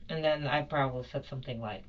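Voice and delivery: usual speaking voice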